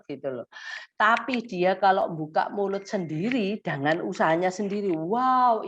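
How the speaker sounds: ordinary delivery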